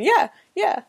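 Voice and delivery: high-pitched sidekick cheering someone on; high imitation of a yes-man; imitating eager yes-man. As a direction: mimic a little tag-along hype man. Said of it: "trail behind him all the time going".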